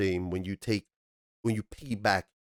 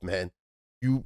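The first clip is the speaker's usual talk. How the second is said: ordinary talk, nothing marked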